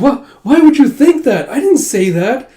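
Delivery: high, loud, indignant protest; flustered denial. Retold or imitated. Yona denies it, flustered.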